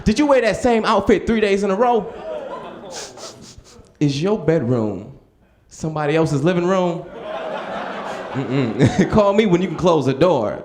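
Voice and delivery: high, mocking, dismissive woman's voice; sassy girl shutting him down. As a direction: play the girl brushing him off with attitude. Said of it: "She was like".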